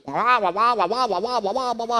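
whiny wah-wah babble, mocking a nagging wife; nagging blah-blah as a wah-wah chant. Mocking a nagging wife's talk.

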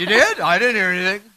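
high, loud mock disbelief; mock surprise. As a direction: play the spouse who heard nothing. Acted spouse deafness.

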